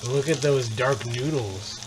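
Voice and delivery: usual speaking voice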